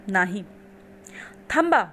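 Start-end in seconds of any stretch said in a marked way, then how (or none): 1.5-1.8 high, loud cry to halt; sudden stop command. A narrator's reading. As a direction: call out sharply to stop him. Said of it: "मी म्हणाले". (मोठ्याने, उंच आवाजात थांबवणे)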